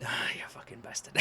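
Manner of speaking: defeated whisper under the breath; whispered curse. Real, not acted.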